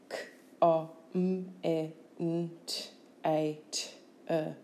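K-O-M-M-E-N-T-A-T-E as slow separate phonemes; sound by sound. Shown as a demonstration. Teacher showing phonemes, K-O-M-M-E-N-T-A-T-E sound by sound. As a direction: sound out K-O-M-M-E-N-T-A-T-E with gaps. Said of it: "Listen carefully to the sounds".

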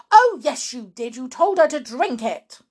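high, loud "oh, yes"; snappy marital blame; accusing retort. Acted. Wife blames her husband, accusing.

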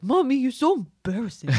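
high, loud goofy dog voice; complaining dog. Acted. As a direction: whine to mom as the embarrassed dog. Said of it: "we think she complains a lot".

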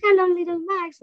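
put-on high, sing-out parent-to-child greeting. Shown as a demonstration. Mother greeting her little child, high child-directed voice. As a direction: pitch it way up for a little one. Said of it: "to my little child".

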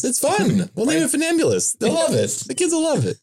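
mock sport founder. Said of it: "the people who did this sport were the ones who named it".

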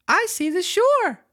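high, slow, excited puppet voice; joyful wooden puppet boy; jerky creaky wooden puppet. Acted. Reads Pinocchio's lines.

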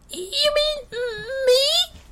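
extremely high, timid mouse squeak; squeaky voice. Acted. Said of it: "an even squeakier voice than usual".

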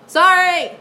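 loud apology. Real, not acted. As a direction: blurt the apology out. Apologizes after audio spiking.

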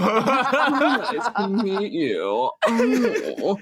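slow, hammed-up man voice; exaggerated man. Gameplay character meeting someone, exaggerated drawn-out man voice. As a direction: draw each word out like a caricature gentleman. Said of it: "that voice is always really fun".

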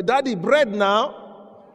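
put-on high, slow child's plea; whiny child. Preacher voices a child asking daddy for bread, slow and high. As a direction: stretch it out like a begging child.

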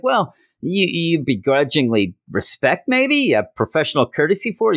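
high, teasing prodding voice. Retold or imitated. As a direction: needle him, voice climbing. Chance teasing Spider-Man.

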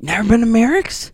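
can't believe he's never been; incredulous. Real, not acted. Disbelief at friend, again.

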